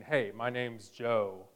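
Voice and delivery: a hint of a creepy stranger, low and hushed; random stranger's pitch. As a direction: play a calm stranger asking you to follow him. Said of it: "randomly and saying".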